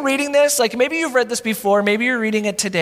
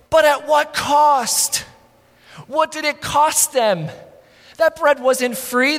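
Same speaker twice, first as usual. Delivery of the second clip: emphatic preaching; 6 semitones higher, slower than usual. Acted. Preacher driving the point.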